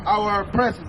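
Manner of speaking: ordinary talk, nothing marked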